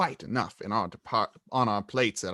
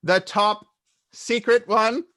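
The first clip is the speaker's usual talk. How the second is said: a bit louder, pointed; raised voice; 9 semitones higher, 7 dB louder than usual. A narrator's reading. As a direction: raise your voice slightly. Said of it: "He raised his voice slightly".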